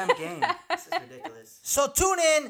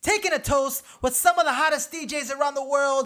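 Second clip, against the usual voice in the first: higher, shouted party host intro; hype man intro; 7 semitones higher, 4 dB louder than usual. Acted. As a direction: hype the crowd up like a club MC.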